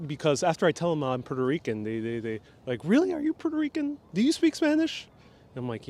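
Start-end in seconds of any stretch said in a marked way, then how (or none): 2.8-5.1 high-pitched mock amazement; surprised questioning. People doubting he is Puerto Rican, high surprised questions. Retold surprised Latin American askers.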